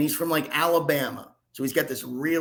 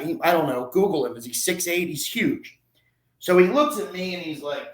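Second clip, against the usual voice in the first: ordinary talk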